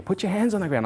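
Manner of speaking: high, urgent mock yell of an order; retold screaming. PA ordering the stroke victim to ground, imitated high yell. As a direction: imitate someone screaming instructions, pitched well up. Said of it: "screaming in my ear".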